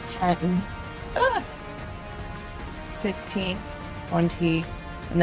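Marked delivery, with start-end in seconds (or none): none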